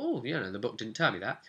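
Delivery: ordinary talk, nothing marked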